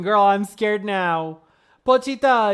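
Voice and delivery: ordinary delivery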